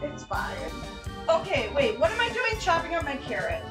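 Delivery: usual speaking voice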